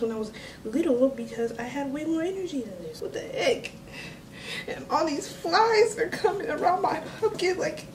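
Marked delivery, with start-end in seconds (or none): 4.7-7.9 high-pitched exasperated whine; whiny complaint. Real, not acted. Vlogger fussing at flies around the pumpkin, whiny complaint. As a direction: plead in a raised, fed-up voice.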